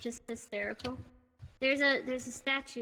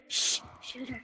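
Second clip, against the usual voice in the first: urgent whisper. Real, not acted. Urgent hushed order.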